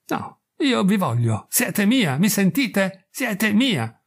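high, urgent and insistent; possessive outburst. Acted. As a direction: claim her as yours, pitched high. Wharton possessivo e acceso. (Wharton reclama Grace, acceso e possessivo)

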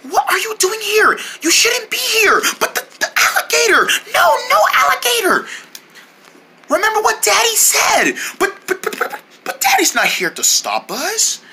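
put-on high, worried little girl; little kid voice. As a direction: play a fretful small child. Child figure voice, higher.

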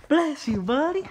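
high, loud sing-out to the dog; doting pet talk. Real, not acted. Owner blessing the dog, high doting pet talk.

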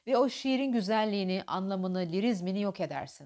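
ordinary talk

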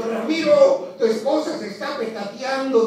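mock shout of a buddy with news; yelling a warning to Ramiro; retold yell. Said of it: "un cuate le gritó".

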